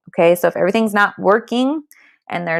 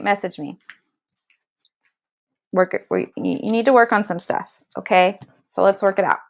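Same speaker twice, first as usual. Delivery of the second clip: ordinary delivery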